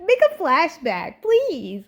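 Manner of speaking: excited plea. Real, not acted. Begging for a flashback.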